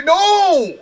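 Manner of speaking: sudden high loud cry of disbelief; shocked shout. Real, not acted. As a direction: yell no as the pin breaks. Pin broken at three.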